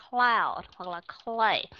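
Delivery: "Cloud" and "clay" slowly drawn out, cl blended voiceless; slow model words. Shown as a demonstration. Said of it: "đọc hai âm trở thành ra là voiceless". (đọc chậm "Cloud", "clay" làm mẫu)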